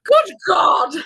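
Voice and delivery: high, loud exclamation; hammed-up horrified shout; mock outrage. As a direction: shout it as if appalled. Mock outrage at co-host's accent.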